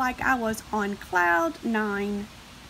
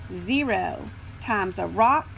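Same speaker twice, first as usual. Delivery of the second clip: plain reading voice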